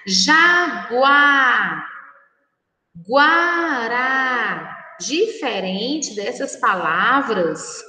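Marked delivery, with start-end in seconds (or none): 0.1-1.9 "Jaguar" drawn out with an audible U glide; stretched teaching "Jaguar", gu pronounced "gw"; U sounded in guá. Shown as a demonstration. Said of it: "escutamos o som da vogal U". 3.1-4.7 "guará" drawn out with an audible U glide; stretched teaching "guará", gu pronounced "gw"; U sounded in guá. Shown as a demonstration. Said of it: "escutamos o som da vogal U".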